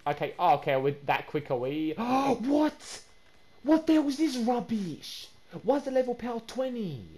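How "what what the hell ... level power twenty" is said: high, loud, slow disbelief; angry outrage. Real, not acted. Gamer discovering the scam, angry disbelief. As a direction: erupt at the rubbish trade. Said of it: "I'm actually so angry right now".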